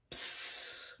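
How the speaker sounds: soft imitated cat spit; cat hiss. A narrator's reading. Narrator imitates cats in the larder, soft hiss.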